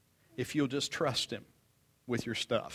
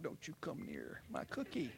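put-on much softer, hushed child's threat; granddaughter warning; 14 dB quieter than usual. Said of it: "Like that".

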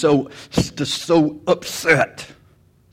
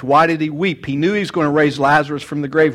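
ordinary delivery

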